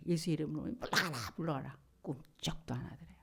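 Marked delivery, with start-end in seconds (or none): none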